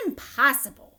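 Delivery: loud flat refusal; scoffing. Acted. Mikey scoffing at Wonka, loud dismissal. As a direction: dismiss it outright. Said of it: "said Mikey T V".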